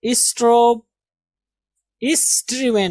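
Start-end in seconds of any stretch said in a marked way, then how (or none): 2.0-2.9 "स्ट्रीवन" with an 'i' as in give; सही उच्चारण. Shown as a demonstration. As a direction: say "स्ट्रीवन" as is-TRIV-en. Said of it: "not स्ट्राइवन". ("स्ट्रीवन" में 'इ' की आवाज़)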